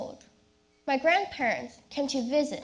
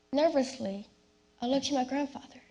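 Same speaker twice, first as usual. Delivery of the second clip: usual speaking voice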